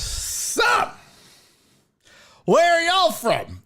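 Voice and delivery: put-on very high, slow, loud drunk drawl; drunk guy voice. Drunk guest asks the couple where they're from, high drawl. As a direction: play the oblivious drunk starting over.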